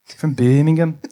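put-on Birmingham in a light Brummie accent; a hint of Brummie on Birmingham. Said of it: "the only word I can say in Brummie".

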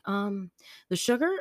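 put-on tentative, questioning kid voice; hesitant little kid. Daughter guessing what was left out, hesitant. Retold child searching for word.

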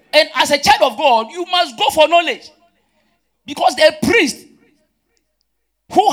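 high, loud exhortation; forceful preaching. Real, not acted. Preacher urges believers to go for knowledge, full force. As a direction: drive it home to the whole congregation.

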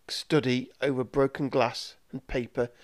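usual speaking voice

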